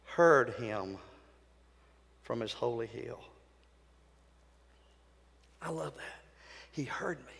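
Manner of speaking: soft, low, sinking almost to nothing; hushed awe. Real, not acted. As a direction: let it drop into reverent quiet. Hushed awe after loud build.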